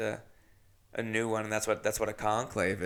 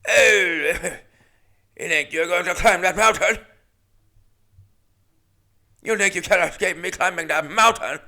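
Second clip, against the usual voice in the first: hammed-up British villain, louder, taunting; 6 semitones higher, 6 dB louder than usual. Lithgow's Cliffhanger villain taunting Stallone, mock British accent. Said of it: "a little bit more British".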